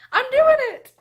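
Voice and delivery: high, loud burst of delight; gleeful announcement. Real, not acted. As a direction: squeal it out like great news. Announcing the tag with glee.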